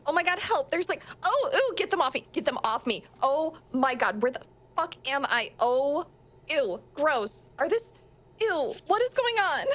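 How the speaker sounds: put-on girl swarmed by rats, frantic and disgusted; panicked disgust. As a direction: shriek like rats are crawling up you. Character panics covered in rats.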